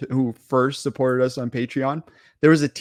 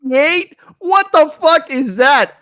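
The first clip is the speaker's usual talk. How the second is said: much higher, incredulous shout, slowing down; outraged disbelief; 11 semitones higher, 6 dB louder than usual. Real, not acted. Host hears the thermostat advice with outraged disbelief. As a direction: spit out the disbelief, real outrage.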